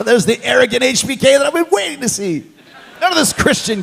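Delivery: high, delighted outburst through laughter; real laughing delight. Real, not acted. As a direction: crow it while laughing, pitch way up. Interviewer delighted by cocky wrestler story.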